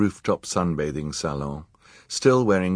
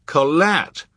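loud, high called name; cry out; 9 semitones higher, 6 dB louder than usual. A narrator's reading. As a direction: call out in recognition. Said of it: "cried Klaus".

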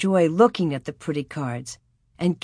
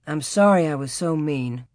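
ordinary delivery, nothing marked

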